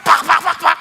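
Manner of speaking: loud, quick "pak" smacks; imitated slapping sounds. Shown as a demonstration. Preacher likens preaching to being slapped, punchy imitated smacks. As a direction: voice a volley of slaps. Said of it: "Para ka sinasampal".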